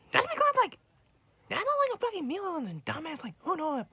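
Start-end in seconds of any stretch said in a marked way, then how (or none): 0.1-2.3 angry rant. Real, not acted. Still ranting at Froggy.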